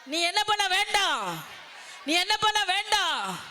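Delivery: preacher voicing Jesus, climbing to a shout; building repeated quote. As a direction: hammer the line three times, growing each time. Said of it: "இயேசு கிறிஸ்து சொல்றாரு".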